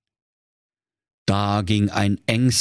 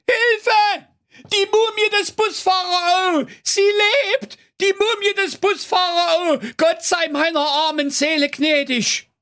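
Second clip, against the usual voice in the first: terrified screaming. A narrator's reading. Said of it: "schrie, so laut er nur konnte". (panisches Schreien, so laut er kann)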